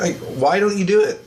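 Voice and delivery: ordinary delivery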